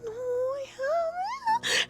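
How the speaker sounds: very high, drawn-out whine; tiny mock-agonized fairy voice; straining fairy. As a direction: squeak it slowly as if holding back. Fairy straining not to respond.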